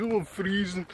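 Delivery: put-on Newcastle accent. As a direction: complain it's freezing, Newcastle style. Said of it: "parody of Mister Neil Morris".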